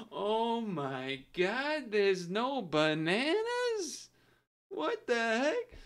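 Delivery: very slow, high-pitched dramatic outburst; dramatic old man. Retold or imitated. Streamer re-enacting the old guy's shock, slow dramatic voice. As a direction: draw it out with theatrical disbelief. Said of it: "It was like a dramatic".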